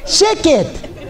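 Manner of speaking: put-on high Russian-accented teacher snapping "Sheket!"; the exasperated old-country teacher hushing a wild kid, high-pitched; high-pitched Russian-accented hushing yell; mock teacher yelling. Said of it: "That's what he said all afternoon".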